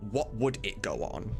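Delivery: ordinary delivery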